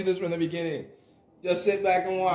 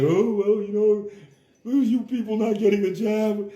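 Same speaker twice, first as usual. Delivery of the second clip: raised, louder prissy mock voice; mocking pro-jab people; 3 semitones higher, 4 dB louder than usual. Mocking jab fans who scold the unvaccinated. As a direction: parody a preachy scold looking down on others.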